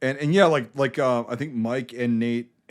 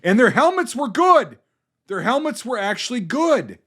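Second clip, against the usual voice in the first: excited; 10 semitones higher, 6 dB louder than usual. Real, not acted. Excited movie gushing.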